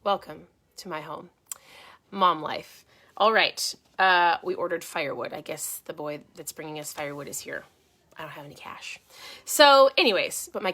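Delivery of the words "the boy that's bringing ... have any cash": very soft, low whisper; whispered aside. Real, not acted. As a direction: say it under your breath. Embarrassed aside during live video.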